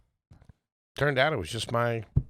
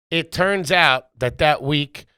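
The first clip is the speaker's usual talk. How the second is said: normal talking voice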